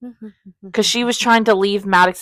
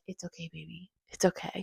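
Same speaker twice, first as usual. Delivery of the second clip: much softer, low comfort; soothing hero; 3 semitones lower, 19 dB quieter than usual. Retold or imitated. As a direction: murmur it gently like a hero calming her. Voicing hero comforting her.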